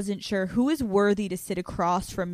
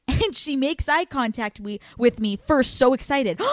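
ordinary delivery

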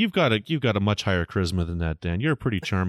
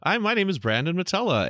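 high mock voice; 10 semitones higher than usual.

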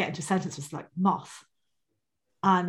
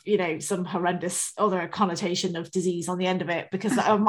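ordinary talk, nothing marked

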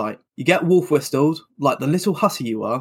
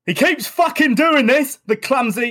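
put-on drunken rant; 10 semitones higher than usual. Drunken [name] ranting about dog.

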